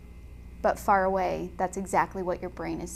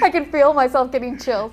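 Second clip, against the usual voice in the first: real giddy excitement, high and loud; loud, high, chills-down-the-spine enthusiasm; excited; 5 semitones higher, 11 dB louder than usual. Real, not acted. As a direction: burst out, thrilled by your subject. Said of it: "I'm getting all excited".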